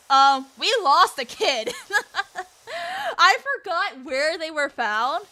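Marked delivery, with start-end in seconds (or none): 2.7-3.1 real high-pitched laugh. Real, not acted. Telling how they lost a kid, high real laughter.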